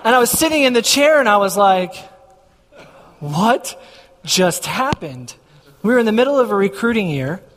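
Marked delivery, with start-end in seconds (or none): 3.2-5.3 slow, drawn-out disbelief with a long pause; mock stunned. Husband reeling after the job offer, mock stunned. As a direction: stretch each word as if dazed.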